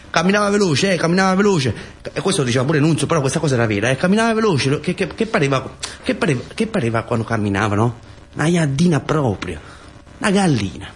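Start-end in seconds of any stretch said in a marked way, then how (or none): none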